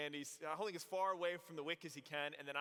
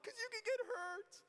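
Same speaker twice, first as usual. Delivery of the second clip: put-on much higher, crying girlish whine; worried girls. Girls warning the rollerblading friend, high worried whine. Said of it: "And they're like".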